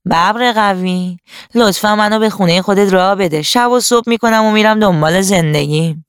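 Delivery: put-on voice of a freeloading beast asking a favour; wheedling animal voice. Freeloader begging the tiger for shelter, put-on pleading voice.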